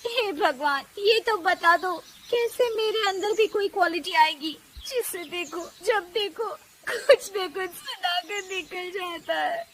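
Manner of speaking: high, pleading complaint to God; tearful prayer. Acted. Daughter-in-law on the roof pleading to God, tearful. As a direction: plead with God on the verge of tears. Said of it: "भगवान की तरफ देखकर कहती है".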